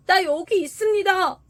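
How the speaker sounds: high, loud acted shout; calling out. A narrator's reading. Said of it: "하고 외치기만 했다면". (높고 큰 소리로 외치듯)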